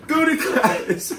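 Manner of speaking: high, slow, drawn-out mock order; imitated patrol kid. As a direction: stretch the order out in a put-on kid voice. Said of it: "he did the".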